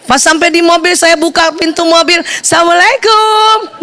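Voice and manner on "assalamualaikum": higher, drawn-out salam; cheerful greeting. Retold or imitated. Wife greeting husband at the car door, cheerful and drawn out. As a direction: sing out a happy hello, stretched.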